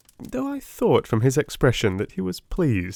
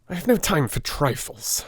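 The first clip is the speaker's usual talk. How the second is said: brusque; 5 semitones higher than usual. Acted. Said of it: "he answered brusquely".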